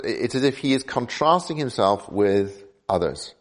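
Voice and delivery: ordinary talk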